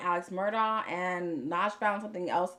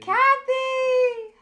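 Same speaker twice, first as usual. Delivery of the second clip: calling out; 11 dB louder than usual. Real, not acted. Greeting a viewer by name.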